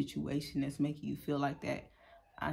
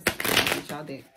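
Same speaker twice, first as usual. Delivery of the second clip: ritual deck call; 7 dB louder, much slower than usual. Pretend. Dealing the tarot deck.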